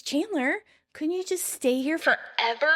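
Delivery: insistent repeat. Retold or imitated. Monica repeating her question to startled Chandler, insistent. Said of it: "She repeats".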